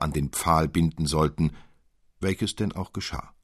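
plain reading voice

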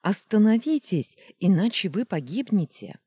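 high loud warning cry; desperate shout. Acted. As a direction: scream at them to stop. Luna screams warning. (отчаянный крик)

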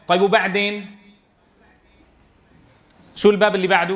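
usual speaking voice

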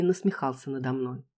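ordinary delivery

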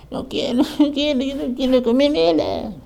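agudo lloriqueo de niña, hammed-up; berrinche de niña imitado. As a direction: whine high like a child refusing food. Hija llorona que se niega a comer. (berrinche infantil imitado, muy agudo)

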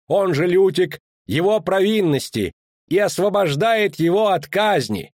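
official proclamation. Acted. Official reading the princess's decree aloud, formal proclamation. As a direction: declaim it to the square.

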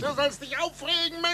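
high, exhilarated shout; excited yell. Acted. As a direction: fling the words back at him. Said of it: "wie ein Narr zu brüllen".

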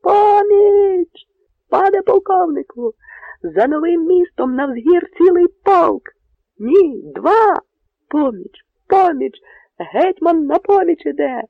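high, loud, jubilant yells; sentry spotting whole regiments on the hill; joyful shouting. Acted. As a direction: cry out the rescue with all your lungs. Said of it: "залунала на монастирському подвір'ї".

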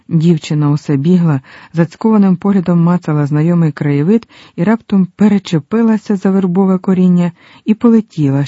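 usual speaking voice

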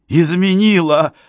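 high, loud, slow outburst; outraged cry. A narrator's reading. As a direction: cry it out in disbelief. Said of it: "вскричал молодой человек".

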